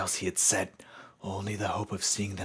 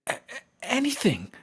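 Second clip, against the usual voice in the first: high, loud, stuttering promise; high excited stammer; stammering eagerly; 7 dB louder than usual. Acted. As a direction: trip over the words in emotion. Pony answers the ghost, overwhelmed.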